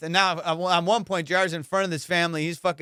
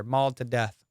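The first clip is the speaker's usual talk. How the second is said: usual speaking voice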